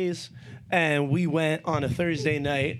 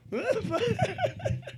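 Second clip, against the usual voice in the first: high giggle; 3 dB quieter than usual. Real, not acted. Laughing after joke, high.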